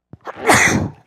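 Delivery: loud sudden sneeze; sneezing. Real, not acted. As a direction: let out one sharp sneeze. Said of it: "Excuse me, I'm gonna sneeze".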